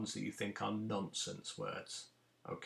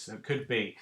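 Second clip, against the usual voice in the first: normal talking voice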